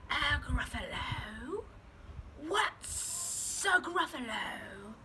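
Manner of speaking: very slow, drawn-out snake question. Acted. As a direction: ask it slowly like a snake. Snake asks in story.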